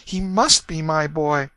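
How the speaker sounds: high, pleading mother's voice. A narrator's reading. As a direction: beg in a high, grieving voice. Grieving mother character voice.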